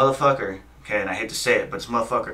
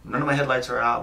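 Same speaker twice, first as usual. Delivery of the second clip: ordinary delivery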